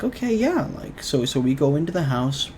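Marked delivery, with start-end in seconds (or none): 0.0-0.7 surprised okay. Retold or imitated. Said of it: "and we were like".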